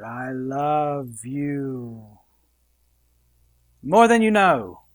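woo her, slow and dreamy; romantic accent. Acted. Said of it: "you gotta talk in a real good accent".

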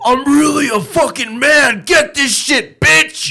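high, loud hammed-up yell; imitated shouting streamer. Streamer revealing he is a man, yelled imitation. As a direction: burst into a mock triumphant shout.

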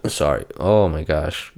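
ordinary talk

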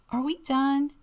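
put-on high-pitched child asking; high little kid voice; little boy's question. A narrator's reading. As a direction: ask it like an eager small boy. Story reader voices little Robbie asking.

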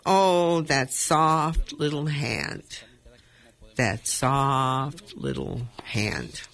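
very slow, drawn-out closing refrain; hushing, slowing end of a poem; poem recital. Acted. Closes own poem reading.

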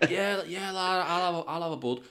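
high, soft, innocent-sounding mock voice; sneaky zombie guest. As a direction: sound harmless while hiding a bite. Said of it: "he's like".

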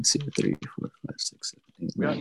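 counting under his breath; whispered counting. Real, not acted. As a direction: whisper the numbers to yourself.

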